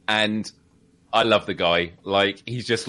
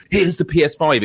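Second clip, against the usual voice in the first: louder, higher mock product reveal; punchy mock presenter announcement; brash announcement; 5 semitones higher, 5 dB louder than usual. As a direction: imitate a showy, indelicate reveal. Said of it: "it was done so delicately and it wasn't like".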